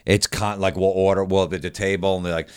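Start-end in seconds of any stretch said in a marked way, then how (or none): none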